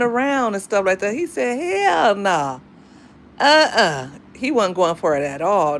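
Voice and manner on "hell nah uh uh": drawn-out Mississippi country refusal, imitated; country accent. Stepdad turning away her crush, mock country accent. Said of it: "He have a country accent".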